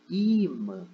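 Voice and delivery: "imã" with a long, high, drawn-out I, then a short mã; stress on the I. Shown as a demonstration. Teacher shows the correct pronunciation of "imã", first syllable I held long. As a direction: stretch and lift the first vowel of "imã". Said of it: "Percebe aqui que o i".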